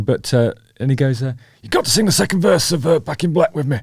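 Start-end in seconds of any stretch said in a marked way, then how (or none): 1.6-3.8 high-pitched mock Yorkshire accent. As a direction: imitate a northern English singer in high Yorkshire tones. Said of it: "from the one I'm doing".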